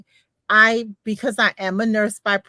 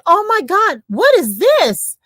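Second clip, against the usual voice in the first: high, louder gasp of delight; higher and louder, stretching what is this; excited lady; 9 semitones higher, 6 dB louder than usual. Retold or imitated. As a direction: gush like a thrilled shopper. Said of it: "And she said".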